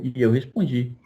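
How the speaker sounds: ordinary talk, nothing marked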